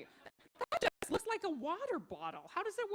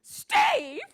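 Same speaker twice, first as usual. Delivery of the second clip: high, loud yell of his name; wife yells for her husband; 11 semitones higher, 11 dB louder than usual. Acted. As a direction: shout his name, at the end of her rope.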